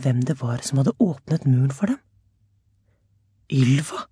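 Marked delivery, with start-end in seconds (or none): 3.5-4.1 gasped. A narrator's reading. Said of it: "gispet hun".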